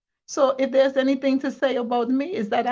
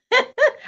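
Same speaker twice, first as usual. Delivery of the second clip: sudden high-pitched laugh bursting out; real high laugh; 6 dB louder than usual. Real, not acted.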